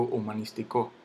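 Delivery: humanístico stressed on CO, humanisti-CÓ; wrong final stress. Shown as a demonstration. Teacher rejecting a wrong version, humanístico stressed on final CO. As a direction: land on the end of humanístico. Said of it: "yo no digo humanístico".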